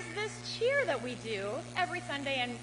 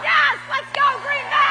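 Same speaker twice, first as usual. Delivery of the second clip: loud, much higher shout, let's go Green Bay; rally shout; 11 semitones higher, 14 dB louder, slower than usual. Real, not acted. Speaker to the Green Bay crowd, loud shout. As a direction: fire up the crowd. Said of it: "rally cheer".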